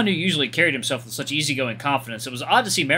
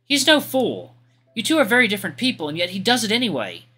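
high, prim and courteous voice; a proper knight's gentle politeness; polite young lady; 6 semitones higher than usual. Acted. Artemy, earnest.

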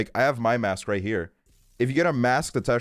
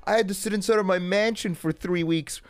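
mock their complaining in a much higher, hammed-up whine, slowing down on the dying part; mocking whiny rich person; 12 semitones higher than usual.

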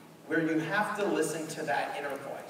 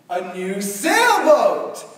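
game show host; 9 dB louder, much slower than usual. Retold or imitated. Host reveals the prize.

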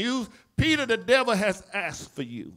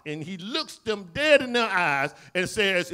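ordinary delivery

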